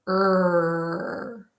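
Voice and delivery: held Er, lips rounded. Shown as a demonstration. Teacher shows rounded lips for R. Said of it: "when you make the R sound".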